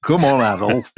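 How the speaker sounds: mock Yorkshire Hey up; hammed-up Yorkshire dad calling Adolf; Yorkshire accent. Yorkshire accent, a father calling his son Adolf. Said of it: "in that accent".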